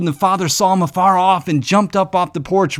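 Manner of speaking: normal talking voice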